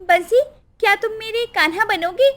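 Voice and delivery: put-on high, sweet little-girl voice. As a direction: invite a playmate sweetly. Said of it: "तभी उसने मधुर स्वर में कहा".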